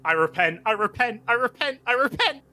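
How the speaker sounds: acted panicked begging, building to very high; desperate shouted plea. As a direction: pitch climbing with every repeat, frantic. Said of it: "and she just shouts".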